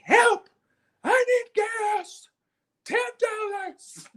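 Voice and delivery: high, slow shouted pleas into a pump; hammed-up screaming old man; mock old man yelling. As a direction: holler for help like a lost old man. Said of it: "he is screaming into the gas pump".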